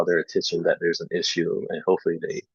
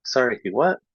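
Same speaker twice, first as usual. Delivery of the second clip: higher puzzled "he what?"; clarification request; 6 semitones higher than usual. Shown as a demonstration. Teacher pretends to mishear a student, asking again. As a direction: ask as if you misheard. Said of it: "Clarification request".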